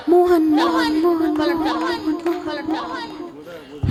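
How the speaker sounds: repeats fading to a breath; echo. Retold or imitated. Poet imitates the hill echoing Mohan, fading out. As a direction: echo the name away into nothing.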